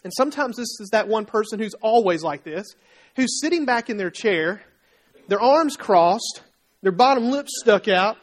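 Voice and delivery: ordinary talk, nothing marked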